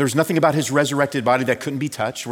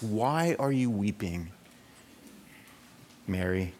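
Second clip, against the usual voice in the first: slow, softer, tender question; loving; 5 semitones lower, 7 dB quieter than usual. Acted. Jesus lovingly asking Mary, slow and soft. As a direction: gently reassure a grieving woman. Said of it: "He lovingly asks".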